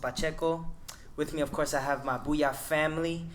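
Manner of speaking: usual speaking voice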